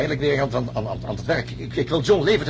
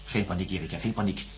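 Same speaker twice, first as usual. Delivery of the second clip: calming; 6 semitones lower, 7 dB quieter than usual. Acted. Doctor calms panicking patient.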